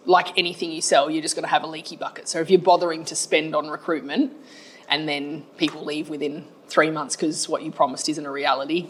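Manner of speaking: ordinary talk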